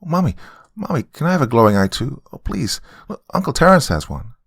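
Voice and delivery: put-on high, excitable child voice; eager little girl. Daughter begging Mommy for glowing eye, eager child. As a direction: beg like a small girl.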